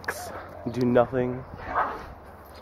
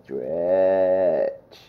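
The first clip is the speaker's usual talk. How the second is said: low, long "Stretch"; coaxing the dog with a slow low call; drawn-out stretch; 5 semitones lower than usual. Acted.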